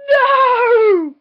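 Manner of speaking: long, high, loud wail; drawn-out dramatic cry of dismay; mock-horror cry. Vlogger reliving losing the suitcase, mock-horror cry.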